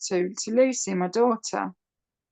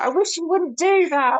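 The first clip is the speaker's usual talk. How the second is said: whiny daughter; 9 semitones higher, 4 dB louder than usual. Retold or imitated. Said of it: "She's like".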